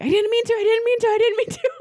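put-on high, panicky little boy's wail; freaking out. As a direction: plead in a high frightened voice, laughing. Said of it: "freaking out".

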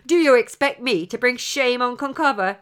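put-on loud, indignant young hero; proud young champion, stung; angry retort. A narrator's reading. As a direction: fling the question back in offense. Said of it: "he retorted angrily".

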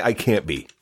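ordinary talk, nothing marked

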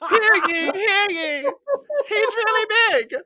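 high, loud laughing proclamation; town crier. Retold or imitated. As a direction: bellow it like a giggling herald.

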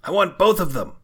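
loud, high-pitched demand; demanding villain. Acted. Villain refusing a trade with a player, loud demand. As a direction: insist greedily, in character.

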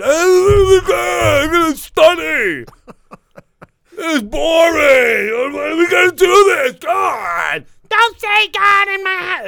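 high, loud whining protest; whiny kids. Retold or imitated. Father imitating his kids' loud whiny complaints about church. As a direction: shout and whine like kids dragged to church.

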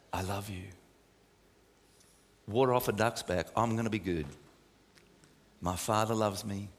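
soft, low and unhurried reassurance; calming voice of God. Acted. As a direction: let the listener feel held and safe. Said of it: "he has such a calming voice".

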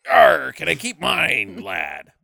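hammed-up pirate growl, dropping low. Nick Cage character asking to keep his accent, pirate voice. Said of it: "you can keep being a pirate".

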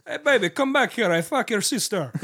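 mock Russian thug catcalling; hammed-up Russian street tough voice; Russian gangster accent. To the room, Russian gangster accent catcalling. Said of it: "you're killing it with that".

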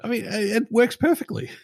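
high-pitched mock insistence; joking, squeaky-high defence; mock protest. Mock defence of a silly alphabet.

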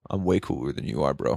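low, laid-back imitation of a singer; retold cool-guy brag in a low voice; too-cool singer. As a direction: mimic a self-important frontman, voice dropped. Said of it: "main singer, he's just like".

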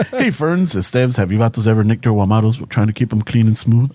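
low, smooth radio ad read; announcer voice. Retold or imitated. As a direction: pitch it like a radio DJ. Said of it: "my announcer voice".

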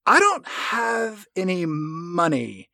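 slow, drawn-out mock admission; awkward confession. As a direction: stretch it out hesitantly, as if stalling. Acting out broke gambler's awkward confession.

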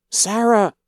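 high, loud wail of a name; anguished scream. A narrator's reading. Mother screaming for her daughter at the block, anguished wail.

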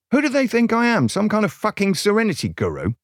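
high-pitched, incredulous complaint; exasperated rant. Acted. Podcaster ranting about listeners' expectations, exasperated. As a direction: protest in comic outrage.

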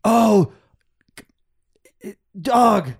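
loud, high call to a dog; guy with dogs. Acted. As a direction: play a dog owner calling out. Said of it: "I'm gonna be the guy with the dogs".

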